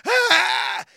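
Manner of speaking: high, very loud startled scream; sudden full-volume fright scream; retold scream. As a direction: shriek as if scared by a face. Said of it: "and I was like".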